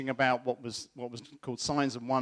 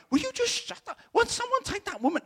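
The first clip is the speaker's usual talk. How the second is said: much higher mock-indignant snap, his pious side; hammed-up outrage, pitched way up; exasperated. Preacher mocking his own churchy impatience, high mock outrage. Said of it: "my religious side going".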